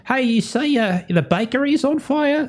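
high-pitched put-on villager voice; chatting villager. Game master voices villagers overheard by Bleedus, high chatty role play. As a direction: ask it casually in a high character voice. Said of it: "like, they're talking".

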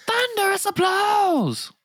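high, slow falsetto announcement; mock grand voice. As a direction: echo it in a silly high voice. Mock echo of cohost's joke.

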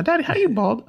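put-on high little child asking; squeaky curious kid. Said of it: "the story I tell my kids".

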